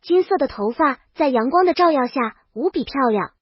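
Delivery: ordinary delivery, nothing marked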